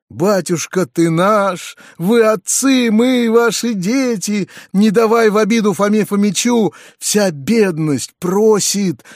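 high, drawn-out cry of a pleading crowd; peasants' crying plea. A narrator's reading. Peasants beg their master not to give them away, crying plea. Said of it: "закричали ещё раз мужики".

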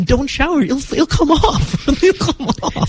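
high laughing plea. Real, not acted. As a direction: beg through laughter. Laughing, pleading she not shower.

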